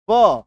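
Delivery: व alone, loud and drawn out; isolated letter sound. Shown as a demonstration. Teacher spelling a word sound by sound, loud isolated व. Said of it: "W लिखा".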